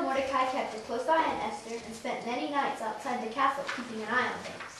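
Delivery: ordinary delivery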